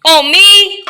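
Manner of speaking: high, loud "who, me?" innocence; mock innocent surprise. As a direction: play it falsely shocked and innocent. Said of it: "you act surprised".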